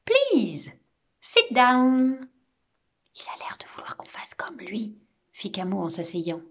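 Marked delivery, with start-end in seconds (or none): 0.0-2.3 loud, drawn-out repeated request; smiling insistence. A narrator's reading. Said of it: "toujours souriant". 3.2-5.0 very soft schoolboy whisper; whispered aside. A narrator's reading. Camo glisse un mot aux autres.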